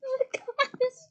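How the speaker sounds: high, loud, laugh-choked exclamation; laughing fit. Real, not acted. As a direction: gasp it out through real laughter. Helpless laughing fit while reading.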